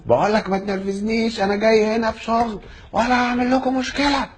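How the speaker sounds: high, irritated protest; worked-up passenger, pitched high; retold angry warning. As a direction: replay the argument with rising irritation. Acted quarrel with airline man.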